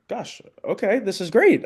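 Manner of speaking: lifted, pleased reaction; pleasantly surprised. Retold or imitated. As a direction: act out happy relief, voice lifting high. Acting out his pleased relief.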